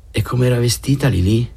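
a hint of mocking Linda; Linda's voice. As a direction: coax the detail out playfully. Linda teasing about Lilì.

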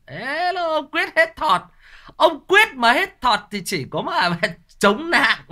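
very high, teasing squeal breaking into laughter; playful teasing cry. Real, not acted. Razzing viewer Quyết about his limp, high giggly teasing. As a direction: tease him in a high, stretched-out voice.

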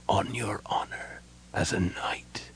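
slow, solemn dying whisper. A narrator's reading. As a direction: breathe it out faintly, word by word. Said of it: "Caramon whispered".

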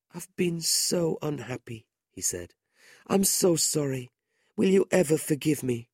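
put-on a frail boy asking forgiveness; mild sadness, pleading; sorrowful boy. A narrator's reading. Dying boy apologises.

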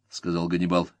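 ordinary delivery

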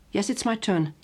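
normal talking voice

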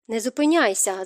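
plain reading voice

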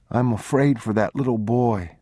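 put-on high child voice; fearful little boy. A narrator's reading. Boy tugging papa's coat, high worried confiding. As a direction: confide the worry in a child's pitch.

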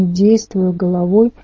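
ordinary delivery, nothing marked

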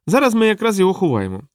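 high-pitched small clown voice; small, high-voiced clown; little clown.

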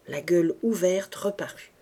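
ordinary delivery, nothing marked

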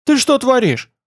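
high-pitched, alarmed protest; startled indignation, voice jumping up; indignant protest. A narrator's reading. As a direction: exclaim in outrage at the teacher. Pupil protests after dodging lightning.

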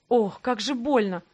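slowed, strained cry of pain; pained exclamation. Acted. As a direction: let the pain of the punch come through, slowly. She hits the lackey, hurts.

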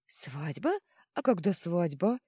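astonished questions, rising at the end; lightly voiced little god, amazed. A narrator's reading. Kipish hearing of the wedding, amazed. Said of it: "изумился Кипиш".

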